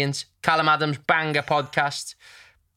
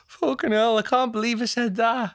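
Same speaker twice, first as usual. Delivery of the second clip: mock shocked regret; 8 semitones higher than usual. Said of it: "and you'll go".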